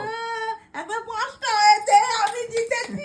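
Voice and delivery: high, tearful winner's cry; shrieking jackpot winner. Real, not acted. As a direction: shriek with joy at winning. Winning the eating contest.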